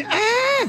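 high drawn-out "eh"; loose, careless long "eh" for Italy; stretched comic shrug sound; Italian shrug noise. Shown as a demonstration. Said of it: "This is a gesture in Italy that means".

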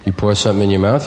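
ordinary talk, nothing marked

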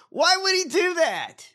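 incredulous. Real, not acted. Incredulous after laughing.

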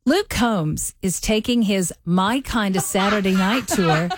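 slow, careful read with the Southern accent suppressed; accent-neutral read. Shown as a demonstration. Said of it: "try to sound as neutral as you can".

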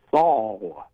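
"Saul" said slowly with an L on the end; Saul for saw. Retold or imitated. Caller showing the Akron "Saul". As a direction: stretch out "Saul" with a clear L. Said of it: "they say the word instead of saw".

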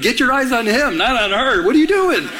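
retold jealous scolding, pitched high; mock-indignant telling-off rising high at the end; upset. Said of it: "I was just so upset".

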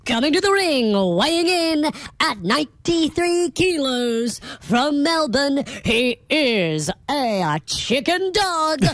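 slow, drawn-out ring announcer call. Shown as a demonstration. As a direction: announce the fighter to the arena. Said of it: "ring announcing like this".

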